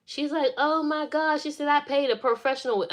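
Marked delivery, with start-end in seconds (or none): 0.6-1.4 high-pitched mock dismay; upset dismay. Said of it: "She was so mad". 1.7-2.9 high-pitched retold complaint; indignant, pitched up; upset protest. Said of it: "She was so upset about that".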